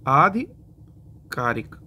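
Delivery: आधिकारिक drawn out slowly, long first आ; slow careful pronunciation. Shown as a demonstration. Teacher dictating आधिकारिक to students, slow. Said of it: "जिसको आधिकारिक लिखते हैं".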